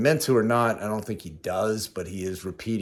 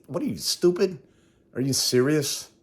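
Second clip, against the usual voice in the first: mock disbelief, pitched up high; scoffing at how dumb that sounds; incredulous retort; 7 semitones higher than usual. Retelling a retort to a woman praising him, incredulous and high.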